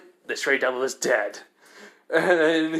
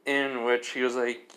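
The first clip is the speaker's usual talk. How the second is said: ordinary delivery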